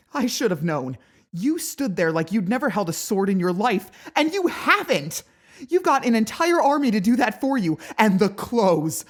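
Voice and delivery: high, loud building outrage; furious rant. Acted. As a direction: tear into him.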